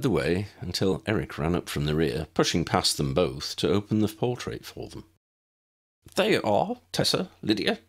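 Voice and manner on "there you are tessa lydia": higher, simpering schoolboy politeness; eager boy simpering at a girl. A narrator's reading. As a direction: fawn over her with a simper. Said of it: "he simpered".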